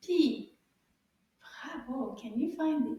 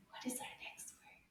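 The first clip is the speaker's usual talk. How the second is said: breathy suspense whisper; whispered mystery to the kids; 16 dB quieter than usual. Acted. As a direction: tease the next word in a hush. Teacher builds suspense.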